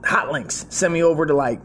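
put-on old Marine boss sending him for hot links; gruff boss voice. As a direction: bark the errand like a crusty boss.

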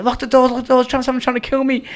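high, urgent pleading; retold panic. Fleeing into seven eleven from his chaser, acted panic. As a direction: plead urgently, pitched right up. Bursts into shop fleeing in story.

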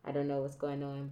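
ordinary delivery

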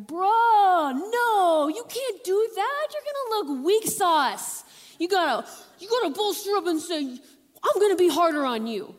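high, loud, brash bro voice pushing the king; loud high-pitched frat-bro egging on; cocky young bros. Retold or imitated. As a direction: hype him up like a cocky buddy.

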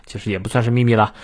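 ordinary talk

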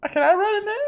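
high, squeaky mock eager plea; eager Hermione. Friend mimics Hermione wanting a dance, squeaky high plea. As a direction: pitch it way up like an impatient girl begging to cut in.

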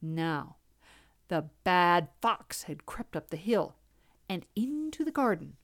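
usual speaking voice

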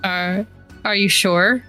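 ordinary talk

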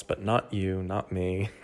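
usual speaking voice

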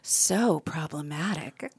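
low, slow and disapproving; disapproving drawl. Shown as a demonstration. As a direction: drag it out with a judging sigh. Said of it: "can you just say problematic".

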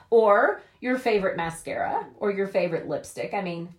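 normal talking voice

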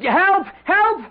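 frantic call for the general; panicked cry for help. Acted. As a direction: yell for help, rising in panic.

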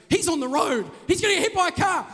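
rising panicked alarm; panicked wife. Retold or imitated. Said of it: "she starts freaking out".

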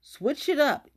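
punchy repeated advice; emphatic advice. Shown as a demonstration. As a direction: drive the advice home. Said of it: "I'm giving you advice right now".

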